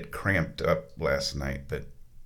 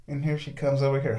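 usual speaking voice

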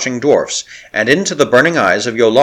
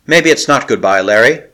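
usual speaking voice

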